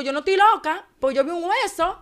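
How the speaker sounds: high, loud insistence; loud, high-pitched desperate denial; frantic retold protest. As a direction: cry out in protest, reenacting the moment.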